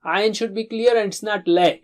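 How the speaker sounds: ordinary delivery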